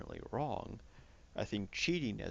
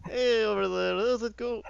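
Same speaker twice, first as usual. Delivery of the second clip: much higher, much louder hammed-up Russian Canadian accent; mock Russian Canadian accent; 13 semitones higher, 11 dB louder than usual. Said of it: "fucking Russian Canadian".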